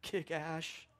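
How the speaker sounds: softly quoted cheer, held back; quiet, restrained imitation of sideline screaming; understated retold scream.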